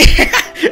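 high, short burst of laughter. Real, not acted. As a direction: laugh mid-sentence. Laughs while recapping the round. (risita aguda)

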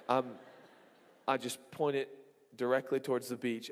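usual speaking voice

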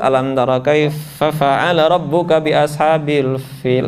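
slow low recitation with clear F in KAIFA and FIL; demonstrated fa recitation. Shown as a demonstration. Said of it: "siapin nanti telunjuk". (lantunan pelan, huruf F jelas)

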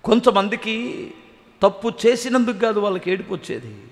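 ordinary delivery

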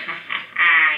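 low acted witch cackle; gloating, deliberate ha-ha cackle; witch's cackle. A narrator's reading. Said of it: "and she cackled".

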